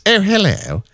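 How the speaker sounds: high, slow, lilting posh hello; posh telephone voice. Retold or imitated. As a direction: put on a posh phone voice. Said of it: "but the posh telephone voice".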